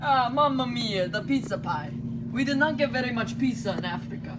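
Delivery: Italian player reporting in a mock Italian accent. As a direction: sing it out like a cartoon Italian. Italy player starts with mamma mia.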